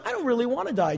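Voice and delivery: ordinary talk, nothing marked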